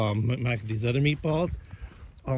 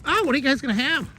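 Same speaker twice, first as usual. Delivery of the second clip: much higher, fast, louder mock mom; imitating mom; 13 semitones higher, 4 dB louder, faster than usual. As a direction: play his mother's bright quick reply. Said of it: "when I call her".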